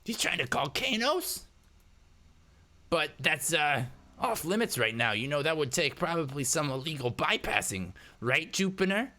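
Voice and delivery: high, suspicious hacker voice; hacker Eddie, incredulous. Acted. Hacker questioning stranger about an illegal call, high incredulous character voice. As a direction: probe the stranger with pointed disbelief.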